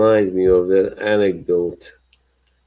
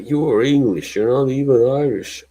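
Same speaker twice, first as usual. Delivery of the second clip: higher-pitched mock accusation; retold accusation; 6 semitones higher than usual. Player challenging manager's Irishness, imitated accusation. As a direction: accuse him like an indignant player. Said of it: "He's like".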